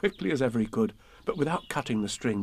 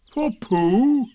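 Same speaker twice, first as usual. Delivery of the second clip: loud, high astonished donkey; high indignant surprise; disbelieving; 11 semitones higher, 8 dB louder than usual. A narrator's reading. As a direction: echo it loudly in disbelief. Eeyore's disbelieving echo of the news.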